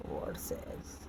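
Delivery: "words as" whispered, voiceless. Real, not acted. Teacher reads the line to herself in a whisper. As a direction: whisper it to yourself. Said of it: "muttered while reading".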